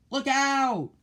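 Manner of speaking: high, loud warning cry; pirate yelling in fear of sharks; frightened shout. A narrator's reading. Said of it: "one pirate shouted".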